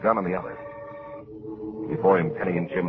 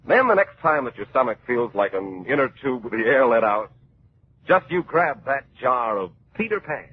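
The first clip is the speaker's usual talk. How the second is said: put-on cheery old-time radio announcer; radio ad read; 3 semitones higher than usual. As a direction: sell it brightly to the kids. Mid-show peanut butter commercial.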